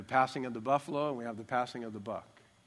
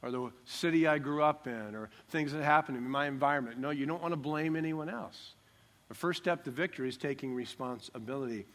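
ordinary talk, nothing marked

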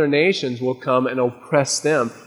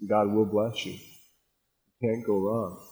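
softer, slow, gentle promise; quiet reassurance; 5 semitones lower, 8 dB quieter, slower than usual. Real, not acted. Preacher softly reassuring his congregation. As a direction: ease the words down low and slow.

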